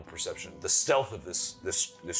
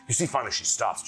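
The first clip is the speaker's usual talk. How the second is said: normal talking voice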